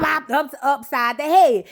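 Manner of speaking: ordinary talk, nothing marked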